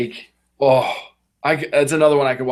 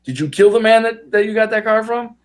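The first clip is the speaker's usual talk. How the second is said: higher, pointed question; suspicious shop owner; 7 semitones higher than usual. Retold or imitated. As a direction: press him hard on where the car came from. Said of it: "He's like".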